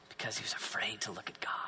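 very soft and low, reverent; hushed awe. A narrator's reading. Preacher tells of Moses fearing God, hushed. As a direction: drop to a low, near-whispered hush.

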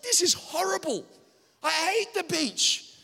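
stressed whining. Retold or imitated. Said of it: "I was like".

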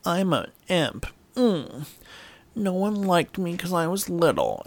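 high, slow, put-on pitiful voice; pitiful act. Tyrion playing for pity with Jaime, mock pitiful and high. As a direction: play the poor little victim. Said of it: "Tyrion kinda plays this like".

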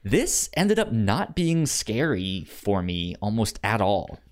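normal talking voice

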